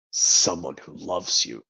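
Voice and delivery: low, slow, soft confession; tender low hush. Acted. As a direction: murmur it lovingly from behind a mask. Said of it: "another impression from this movie".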